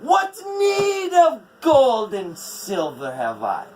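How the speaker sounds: loud, high, defiant refusal; angry giantess. A narrator's reading. Skadi rejecting Odin's gold, loud and furious. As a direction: spit it back in contempt.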